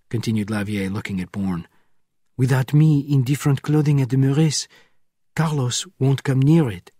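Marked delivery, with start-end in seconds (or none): 2.4-6.9 a hint of a higher, pleading woman's voice; lightly voiced frightened woman. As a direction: voice the nun begging to help, urgent. Lavier voiced in scene.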